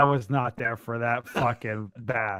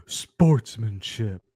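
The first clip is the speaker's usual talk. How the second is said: slow, drawn-out mocking word; slow mocking Sportsmanship; mocking crowd quote; 4 semitones lower, much slower than usual. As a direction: sneer the word Sportsmanship slowly as the crowd would. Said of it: "the crowd who's like".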